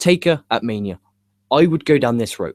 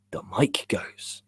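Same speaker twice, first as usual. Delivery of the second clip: slow hushed ghost-story whisper; spooky whisper; 5 semitones lower, 9 dB quieter, slower than usual. Pretend. Spooky remark about ghost.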